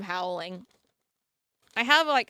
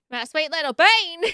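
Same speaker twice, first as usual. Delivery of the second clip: louder, slow affectionate coo; 4 semitones higher, 9 dB louder than usual. Real, not acted. Cooing over her tiny dog, slow and doting.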